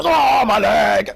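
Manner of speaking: high, loud, drawn-out goofy yelp; mocking a fighter's hurt sound effect; silly game cry. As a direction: mimic a bad game audio cue. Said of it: "he would go".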